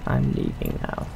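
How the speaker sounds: ordinary talk, nothing marked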